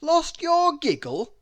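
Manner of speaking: bewildered. Acted. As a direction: sound utterly baffled. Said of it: "he asked in bewilderment".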